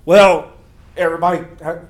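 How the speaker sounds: a hint of a gruff Crazy Larry. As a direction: hurry the caller along, loud and fast. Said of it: "I'm doing my best Crazy Larry voice".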